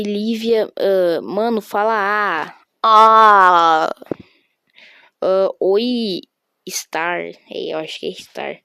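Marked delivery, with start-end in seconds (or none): 2.8-3.9 loud ah. Pretend. Shouted noise.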